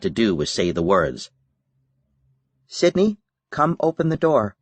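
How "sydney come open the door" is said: higher, sweet coaxing voice; coaxing older sister. A narrator's reading. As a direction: lure her out gently. Sister coaxing through the door.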